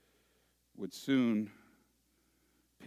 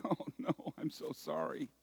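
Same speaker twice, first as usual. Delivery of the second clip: soft, higher, hammed-up apology; mock sorrow; 6 semitones higher, 6 dB quieter than usual. Preacher mocking the wrong idea of weeping, soft and mock-sorry. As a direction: fake a meek little sorry. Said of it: "You think they're weeping like".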